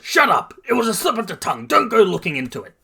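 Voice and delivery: high, fast flustered shout; yelling. A narrator's reading. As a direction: snap back loudly and hurriedly. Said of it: "Bakugo yells".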